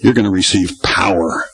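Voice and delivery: low, even, unemphatic voice; understated delivery. Retold or imitated. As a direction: say power low, without any punch. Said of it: "the inflection of His voice".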